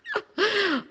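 a short, high embarrassed giggle. Real, not acted. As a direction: laugh at your own silly dream. Laughs at her own fantasy.

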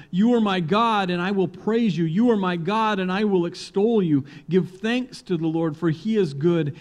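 joyful preacher proclaiming; triumphant proclamation. Acted. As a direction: ring it out in victory. Festal psalm proclamation.

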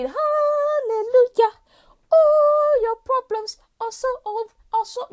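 high, slow, hammed-up rejoicing cry; mock hallelujah. People cheering that September fixes everything, in a mock hallelujah. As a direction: sing out a fake gospel relief.

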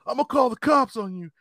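mock a scared guy threatening; imitating a shaken man; whiny threat. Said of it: "he's like".

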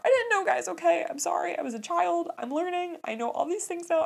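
high-pitched, squeaky self-defence; mock-whiny high apology; defensive pleading. Real, not acted. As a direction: plead innocence to the audience, voice way up. Defends herself to the viewers.

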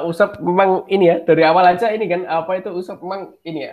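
ordinary delivery

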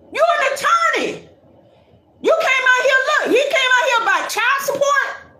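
hyped up about the comment; thrilled, high-pitched exclaiming; excited. Real, not acted. Excited reading of viewer comments.

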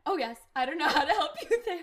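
loud, high, laughing delivery. Real, not acted. Reading own answer to co-host, laughing loudly. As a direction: crack up while reading it out.

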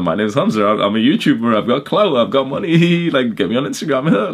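high, boastful self-parody with laughs; hammed-up clout-chasing YouTuber; mock show-off. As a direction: play a bragging influencer. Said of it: "could have stayed around and be like".

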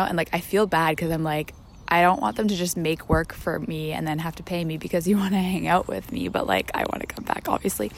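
ordinary talk

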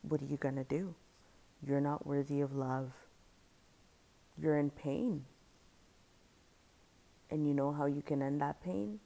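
lightly voiced intrusive thought; inner dark thought. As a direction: voice the thought that tempts you, understated. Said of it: "the first time that thought came up".

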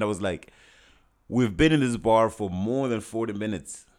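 normal talking voice

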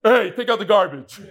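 lightly barked order; a hint of a bossy wife. At home, the wife orders her husband around bossily. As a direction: nag him like a fed-up wife. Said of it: "she comes home".

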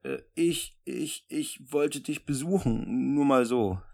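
slow, halting, shy stammer; nervous stammer. Acted. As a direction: stumble over the words nervously. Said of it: "Tico war ziemlich nervös".

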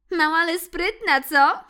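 high, loud gloating mouse with a laugh; giggling mouse. Acted. Mouse Ixi gloats at the muddy cat, giggling. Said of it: "powiedziała myszka, chichocząc".

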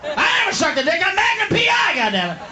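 high, shouted mock outrage; hammed-up furious protest; upset indignant star. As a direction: imitate an offended celebrity yelling denials. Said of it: "He got all upset".

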